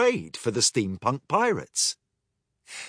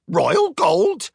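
even higher squawked repeat; parrot squawk; 13 semitones higher, 6 dB louder than usual. Acted. Parrot repeats royal gold in higher squawk. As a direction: screech it again, parrot-style. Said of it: "Twitter squawked".

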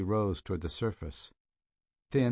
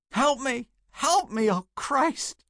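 high, loud panicked scream; agonized screaming; 6 dB louder, slower than usual. A narrator's reading. Burgess screaming at Peters from the boat, agonized shriek. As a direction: shriek in burning pain. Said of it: "Burgess cried, then screamed again".

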